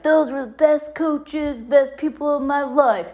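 playful imitation of Chad praising coaches; imitating Chad. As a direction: quote Chad fondly in a put-on voice. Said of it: "Chad was like".

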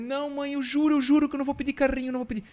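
put-on high, fast child's pleading; a little boy swearing he'll behave; high-pitched kid begging his mom. Child pleading in retold story.

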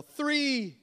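high, loud shout back; yell. Pretend. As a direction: yell the answer from afar. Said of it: "And they yell".

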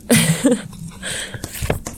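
breathy chuckle; embarrassed laugh. Real, not acted. Writer confessing to published childhood poetry, embarrassed chuckle.

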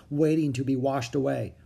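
usual speaking voice

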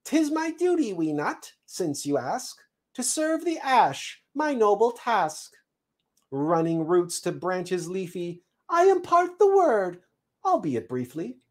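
high, swooping squirrel voice; proud squirrel messenger. Acted. Squirrel explains his task to the seed, proud chirp. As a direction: declare the noble duty in a chirpy high pitch.

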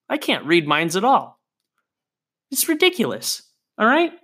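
exasperated, voice jumping up high; worked-up rant. Real, not acted. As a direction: rant like you've got yourself all worked up. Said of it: "got myself all worked up during that rant".

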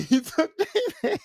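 high, cracking up mid-sentence; laughing. Real, not acted. Storyteller cracking up over the bathroom grab, laughing. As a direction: laugh through the words.

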